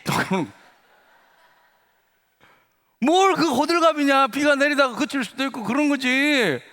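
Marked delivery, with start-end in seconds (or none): 3.0-6.6 high, loud mock dismissal; pooh-pooh it in a raised, acted voice; scoffing retort. Classmate brushing off the miracle, scoffing loudly.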